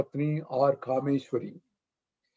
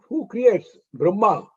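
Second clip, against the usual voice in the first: usual speaking voice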